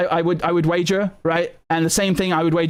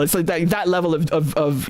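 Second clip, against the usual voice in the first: normal talking voice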